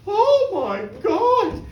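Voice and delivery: high, loud, slow gasp of horror; hammed-up alarm; mock shock. Shocked exclamation.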